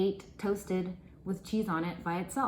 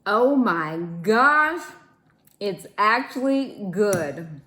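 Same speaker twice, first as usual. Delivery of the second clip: loud, slow amazed exclamation; surprised delight; 2 semitones higher, 9 dB louder, slower than usual. Real, not acted. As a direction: blurt it out, amazed. First bite, surprised delight.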